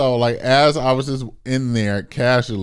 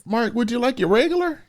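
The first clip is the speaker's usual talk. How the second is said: high, eager service voice; high, sugary counter-staff question; sweet cashier voice. Retold or imitated. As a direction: offer it like a fawning barista. Voicing the cashier to Mark.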